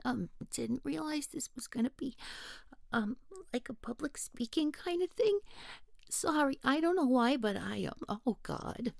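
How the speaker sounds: flustered and losing her nerve mid-sentence; nervous. Acted. Randy to the group, caught off guard, nervous and high. Randy panics about speaking publicly.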